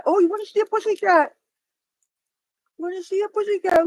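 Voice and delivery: high, sing-song cat-calling voice. Real, not acted.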